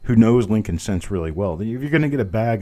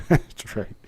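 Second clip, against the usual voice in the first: ordinary talk, nothing marked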